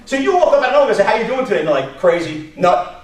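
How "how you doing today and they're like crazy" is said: ordinary talk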